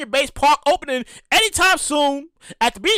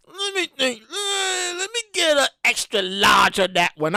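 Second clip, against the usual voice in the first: mock anguish. Mock agonized order choice.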